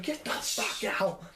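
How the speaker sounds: slow, strained mock yell; imitated scream. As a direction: act out mom's furious order, stretched out. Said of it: "she screams".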